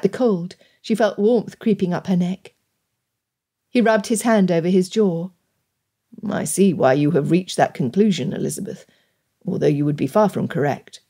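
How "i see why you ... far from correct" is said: low, measured male character voice; reserved gentleman suitor. Acted. Gentleman correcting Elizabeth's conclusion, reserved. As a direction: voice him low and formal.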